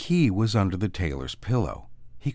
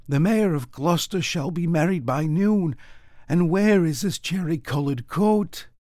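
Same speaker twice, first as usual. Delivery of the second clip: put-on old tailor fretting; 8 semitones higher than usual. A narrator's reading. Tailor worrying about the Mayor's coat, anxious old voice. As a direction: fret like a worn-out old tailor. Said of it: "said the tailor".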